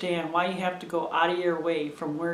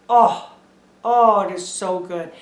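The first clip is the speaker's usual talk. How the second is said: savoring; 7 dB louder than usual. Real, not acted. Savoring her tea.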